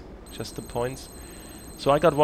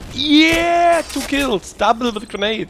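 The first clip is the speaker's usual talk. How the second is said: excited shout; 10 dB louder, slower than usual. Real, not acted. Celebrating a double kill.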